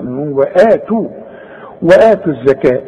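ordinary delivery

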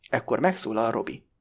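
plain reading voice

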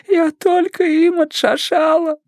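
high, slow crying voice; sobbing young woman, words dragged out; tearful. A narrator's reading. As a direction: whimper it out through tears. Stephanie still sobbing in the story.

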